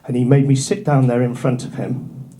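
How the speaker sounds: ordinary talk, nothing marked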